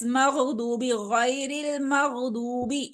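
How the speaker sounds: मग़दूबी drawn out very slowly, ग़ held still; slow model reading. Shown as a demonstration. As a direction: model it slowly, gently rising at the end. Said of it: "again हिलना नहीं चाहिए". (बहुत धीमे, ग़ को स्थिर रखकर)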